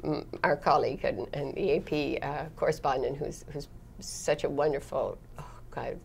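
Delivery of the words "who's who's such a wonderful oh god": whispered, choked with feeling; emotional whisper. Real, not acted. Praising her dear colleague, a soft emotional whisper. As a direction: barely breathe the words out, moved.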